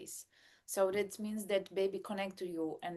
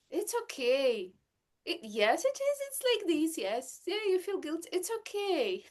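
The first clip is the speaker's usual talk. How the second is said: higher, lightly voiced husband soothing her; husband reassuring; 8 semitones higher than usual. Mom imitating husband Marco calming her guilt, mildly reassuring. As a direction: play the husband calming her down, a bit singsong. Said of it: "And he's like".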